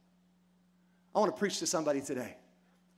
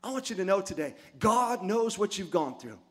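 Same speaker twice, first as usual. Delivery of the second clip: high, loud preacher's cry over music; preaching at full force; 3 semitones higher, 3 dB louder than usual. Real, not acted. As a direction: preach it, building to a shout.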